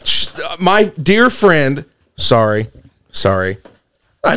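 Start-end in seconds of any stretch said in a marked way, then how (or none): none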